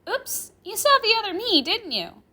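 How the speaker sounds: put-on high, loud, playful girl; amused. Girl amused at the professor's confusion, playful. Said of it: "chuckling at my confusion".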